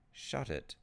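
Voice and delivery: ordinary delivery, nothing marked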